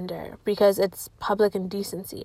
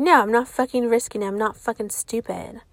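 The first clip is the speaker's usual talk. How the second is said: usual speaking voice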